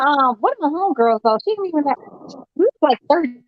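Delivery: ordinary talk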